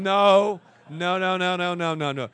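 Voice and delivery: loud repeated no, dropping off; emphatic no. Real, not acted. Preacher loudly rejecting the audience's excuse. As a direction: shut the excuse down hard.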